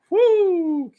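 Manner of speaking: high, loud whoop; woo cheer. Real, not acted. Viewer whooping as the interviewer appears on screen. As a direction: whoop like a fan.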